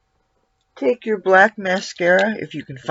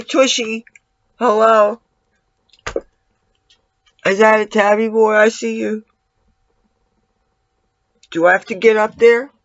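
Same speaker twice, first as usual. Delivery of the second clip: louder, higher call up to the cat; 3 semitones higher, 4 dB louder than usual. Real, not acted. Calling to the cat.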